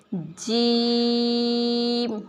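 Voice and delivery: جیم held very long and said firmly; long hard jeem. Shown as a demonstration. Teacher drawing out جیم slowly for the class. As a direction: stretch جیم out, teeth together. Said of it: "جیم کو سختی سے ہم پڑھیں گے".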